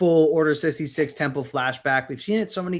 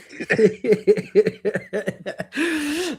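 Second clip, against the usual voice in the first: laughing; 7 semitones higher than usual. Real, not acted. Laughing at Twi'lek joke.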